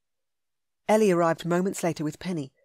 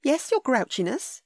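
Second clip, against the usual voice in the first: higher, slow playful jab; teasing; 8 semitones higher, 3 dB louder than usual. A narrator's reading. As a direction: tease him fondly. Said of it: "She chuckled".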